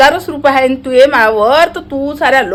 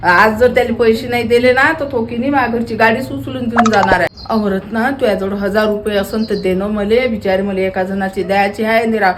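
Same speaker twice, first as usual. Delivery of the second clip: meek pleading; 4 semitones lower than usual. Retold or imitated. Woman reenacting how she begged her friend for a loan, meek lower plea. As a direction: humbly beg the friend for money. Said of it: "पैसे मागताना काय म्हणत होती हो तू?".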